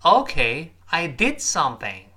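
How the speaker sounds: loud rising Okay, then DID stressed and falling; "Okay" lifted, "did" hit, "something" dropping. Shown as a demonstration. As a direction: repeat the model with emphasis on "did". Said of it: "注意语音、语调、轻重音的变化".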